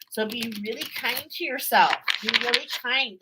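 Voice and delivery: normal talking voice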